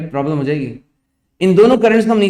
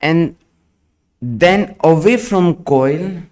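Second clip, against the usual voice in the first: slow, word by word dictation; dictating; much slower than usual. Acted. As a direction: dictate slowly for students to write. Dictation continues.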